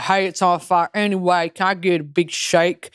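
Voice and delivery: mocking imitation of a Southern guy; southern accent. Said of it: "this fucking southern guy being like".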